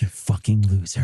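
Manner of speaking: low mock announcer sneer; lightly voiced low commercial announcer; mock ad insult. Parodying commercial.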